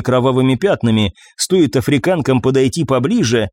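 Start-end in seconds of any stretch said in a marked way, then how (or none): none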